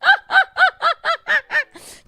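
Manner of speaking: high, loud pulsing laugh; real laugh. Real, not acted. Streamer amused by own recipe, high loud laugh.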